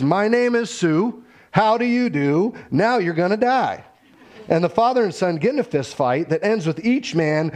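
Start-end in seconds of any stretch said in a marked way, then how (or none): 0.0-3.8 threatening son. Retold or imitated. Son confronting his father at the bar, menacing. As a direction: announce yourself, then threaten. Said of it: "he says".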